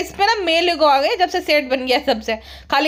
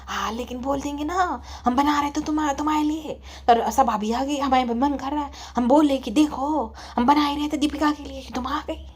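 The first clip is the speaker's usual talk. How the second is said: soft-spoken mother; 6 dB quieter than usual. Acted. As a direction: play a mild mother, half whispering. Mother figure answers. (धीमी, दबी आवाज़ वाली अम्मी)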